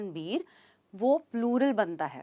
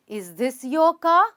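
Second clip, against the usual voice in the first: slow, louder question, "car" lifting; rising question tone; 6 dB louder, much slower than usual. Shown as a demonstration. Teacher giving intonation practice, tone rising on "car". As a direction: ask slowly, tone climbing on "car". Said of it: "practice करना इंटोनेशंस की भी।".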